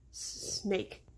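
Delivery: softly stuttered, trembling whisper of fear; quiet, rattling. A narrator's reading. Boy spots a snake by the log, quiet and rattling. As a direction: let the word rattle out quietly, barely voiced. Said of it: "he said quietly, his voice rattling".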